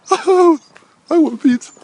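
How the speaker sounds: put-on high, loud childish squeal; squealed craving. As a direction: whine it like a greedy kid.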